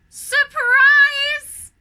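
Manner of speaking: loud, high cheerful cry; excited shout. A narrator's reading. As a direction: throw it out like a party surprise. Joyful reveal to the snowman.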